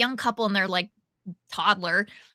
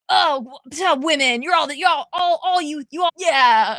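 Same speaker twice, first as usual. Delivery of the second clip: loud, higher mock heckling; hammed-up heckler, voice raised; imitated heckler shouting; 5 semitones higher, 6 dB louder than usual. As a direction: stumble loudly through a mock catcall. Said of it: "shouting stuff at us".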